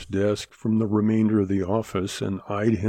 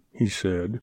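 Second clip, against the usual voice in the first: usual speaking voice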